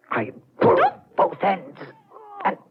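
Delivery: building from tense to frantic shriek; rising frenzy. Acted. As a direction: let the madness climb to a scream. Strangling, narrator's frenzy builds.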